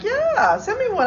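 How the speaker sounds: high eager reply. Retold or imitated. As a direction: pitch it up, eager. Acting her own eager reply.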